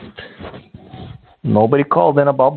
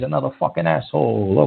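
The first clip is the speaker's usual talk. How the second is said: ordinary talk, nothing marked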